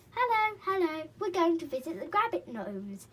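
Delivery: high, loud pixie greeting; lightly voiced pixie; cheery pixie. A narrator's reading. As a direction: call out like a merry pixie. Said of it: "said the pixie".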